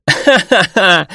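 slow, high, hammed-up laughter; loud laugh. Liang bursting into relieved laughter, high slow laugh. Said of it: "засмеялся во весь голос".

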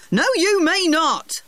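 put-on high, slow and firm old gardener; firm refusal. Gardener firmly refusing the boy, slow high voice. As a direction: refuse him, slowly and firmly, pitched high.

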